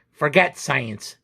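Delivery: ordinary delivery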